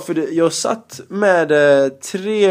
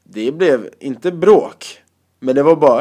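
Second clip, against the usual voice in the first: ordinary talk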